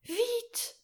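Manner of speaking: very high, urgent cry; a girl's impatient, very high "Vite!". Acted. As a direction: hurry him up with impatience. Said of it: "répéta impatiemment".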